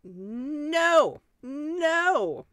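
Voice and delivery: loud high shouted no; horrified no. Real, not acted. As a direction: recoil in horror, yelling. Horrified at book mention.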